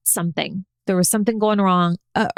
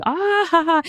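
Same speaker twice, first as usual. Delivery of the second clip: high, teasing ha-ha; a brother-in-arms mocking his sick rival; mocking laugh; 8 semitones higher than usual. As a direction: tease her with a gloating laugh. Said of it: "making fun of me".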